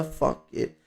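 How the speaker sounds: normal talking voice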